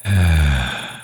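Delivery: long falling sigh of relief; relieved sigh. Acted. Vampire spared by the farmer, relieved sigh. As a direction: let the tension out in a breath. Farmer has lowered the weapon.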